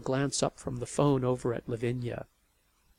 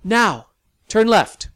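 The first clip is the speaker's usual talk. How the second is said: raised, slow and deliberate; firm direction; 8 semitones higher, 12 dB louder, slower than usual. Acted. Sally directing Lavinia, loud slow command. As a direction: call out the turn loudly and slowly.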